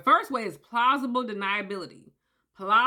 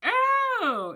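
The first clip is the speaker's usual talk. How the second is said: dismayed; 12 semitones higher, 3 dB louder than usual. Retold or imitated. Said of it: "And you're like".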